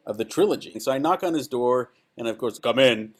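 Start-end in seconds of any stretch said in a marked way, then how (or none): 2.6-3.1 James Earl Jones voice. Retold or imitated. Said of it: "that great voice".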